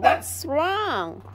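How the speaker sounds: high, slow mock-English talk; put-on English voice. Vlogger mock-lecturing the barking dog, high put-on English voice. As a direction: play a prim foreigner talking down to a dog.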